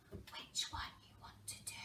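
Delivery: whispered question, no voice. Shown as a demonstration. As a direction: whisper it softly to the class. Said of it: "I want you to whisper".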